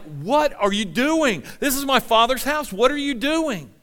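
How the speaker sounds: loud, high, indignant demand; angry outburst. Acted. Jesus confronting traders in his Father's house, angry outburst. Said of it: "he is angry".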